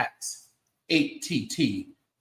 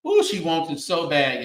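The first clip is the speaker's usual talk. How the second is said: usual speaking voice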